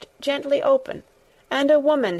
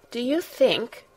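awkward; 3 semitones higher than usual. A narrator's reading. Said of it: "a little awkwardly".

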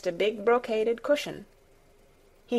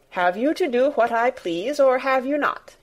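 put-on sharp, rising demand from a spoiled boy; insist, louder at the end; 5 semitones higher, 4 dB louder than usual. A narrator's reading. Colin demanding Martha obey him, louder demand. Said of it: "he demanded".

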